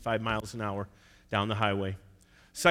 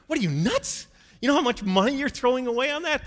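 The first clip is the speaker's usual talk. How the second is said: much higher, louder disbelief; incredulous boss; 12 semitones higher, 4 dB louder than usual. Retold or imitated. Boss scoffing at the salesman dropping an account, incredulous. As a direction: play the baffled boss. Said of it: "his boss really didn't get it".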